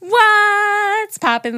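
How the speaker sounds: high, loud, long drawn-out holler; hammed-up greeting. Host reading listener's greeting to both, whooping it out. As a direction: stretch the opening word way out, playfully.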